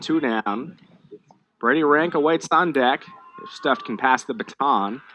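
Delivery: ordinary delivery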